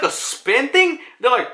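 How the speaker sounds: ordinary talk, nothing marked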